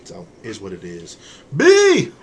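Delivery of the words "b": sudden high, loud yell; calling out. Real, not acted. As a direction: shout out to get someone's attention. Calls out to another person.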